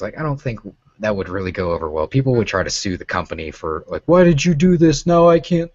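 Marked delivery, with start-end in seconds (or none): 4.1-5.7 high, loud whining complaint; whiny complainer. Retold or imitated. A customer whining at the company in a high voice. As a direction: whine like a hurt customer.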